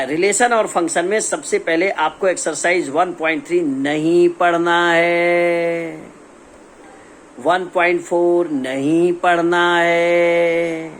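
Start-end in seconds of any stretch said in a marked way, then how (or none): 3.6-6.1 slow, sustained, teasing proclamation; drawn-out announcement. Real, not acted. Announcing deleted exercise with drama. 8.5-10.9 slow, sustained, teasing proclamation; drawn-out announcement. Real, not acted. Repeats the dramatic announcement.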